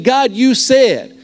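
slow reverent "God, you said"; slow emphatic prayer. Shown as a demonstration. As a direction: lift "God, you said" slowly, each word weighted. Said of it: "I'm saying".

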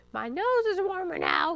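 high fox voice calling. A narrator's reading. Fox calls to Hamlet through the door, calling out. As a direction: call through the door in a put-on voice. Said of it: "he cra-, called".

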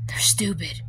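sneaky whispered insult. Acted. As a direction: whisper it behind their back.

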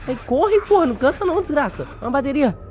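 high panicked shout while fleeing; panicked yelling. Real, not acted. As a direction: yell in panic, running for your life. Chased by monster.

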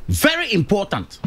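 ordinary talk, nothing marked

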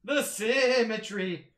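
over-the-top mock despair; mock grumpy old man. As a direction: wail like a cranky grandpa over ruined work. Mock lament at ruined build.

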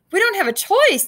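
loud, high shouted reply; hollered back. A narrator's reading. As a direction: holler back over the storm. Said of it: "Duck hollered back".

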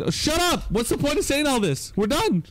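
high-pitched, exasperated cut-off; exasperated outburst. Real, not acted. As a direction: shut the co-host down, pitch way up. Cutting off co-host's pointless argument.

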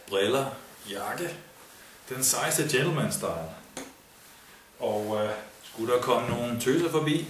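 bragging festival guy. Acted. A cocky lad brags to listeners about his festival kit. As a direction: show off your gear like a swaggering lad.

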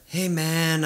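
lightly voiced friend, drawn-out greeting; a hint of Al asking a favor; impression of Al. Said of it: "That's a pretty good Al impression".

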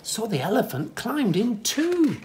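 ordinary delivery

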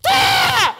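very high, loud shriek of fright; jump-scare yelp, full shriek; startled scream. Acted. Puppet scares a woman.

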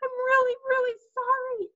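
put-on high girlish wail, climbing; crying apology. A narrator's reading. Sheila apologises to Fudge's mother, crying. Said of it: "Sheila cried".

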